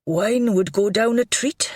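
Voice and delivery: plain reading voice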